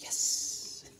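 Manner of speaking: soft voiceless triumphant yes; a private little victory whisper. Real, not acted. Inner triumph at her misfortune.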